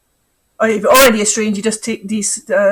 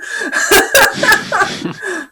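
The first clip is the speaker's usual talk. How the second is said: laughing; 10 semitones higher than usual. Real, not acted. Laughs at own complaint.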